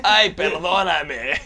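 slow, loud, melodramatic Spanish plea. Retold or imitated. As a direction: beg forgiveness like a telenovela. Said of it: "If they do it in Spanish".

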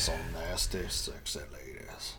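low, slow seductive croon; sexy deep voice. Pretend. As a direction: purr it like a soul singer.

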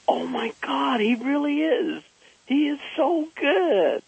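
put-on wife marvelling at the boy's dance; amazed wife. Wife June raves about the boy's moonwalk, high and amazed. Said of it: "my wife, June, says".

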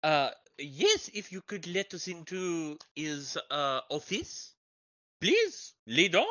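affected, halting foreign character voice; visiting foreign journalist. Acted. Foreign journalist answering the local gossip, halting and affected. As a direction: search for the word with foreign politeness.

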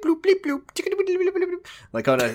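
high squeaky bleep-bloop noises, imitating a computer; mock computer beeps.

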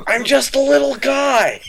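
high, slow, shouted little guy voice; mock little guy. Friend joining the bit, slow high mock shout. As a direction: shout it slowly in a tiny high voice. Riffing, laughing.

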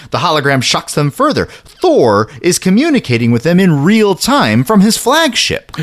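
excited. A narrator's reading. Excited build in narration.

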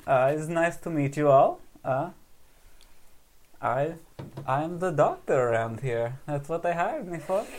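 bright squeaky sing-song welcome; Sova character voice. Acted. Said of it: "did we figure out character voices".

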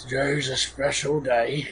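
lightly voiced executioner making a grand announcement; a hint of a showman executioner; mild theatrical executioner. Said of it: "One of [name]'s three character voices".

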